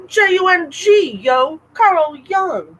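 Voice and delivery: high, slow goofy spelling out; playful drawl. Pretend. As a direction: ham up the name playfully. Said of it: "you can go ahead and stop".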